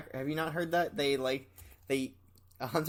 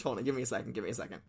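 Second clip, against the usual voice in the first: ordinary talk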